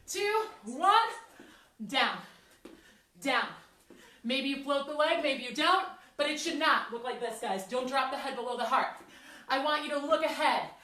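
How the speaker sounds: usual speaking voice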